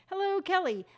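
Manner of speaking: ordinary talk, nothing marked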